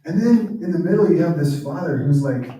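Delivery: ordinary talk, nothing marked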